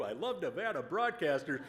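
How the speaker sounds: usual speaking voice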